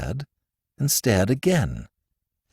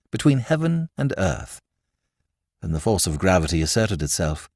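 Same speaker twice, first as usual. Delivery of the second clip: ordinary delivery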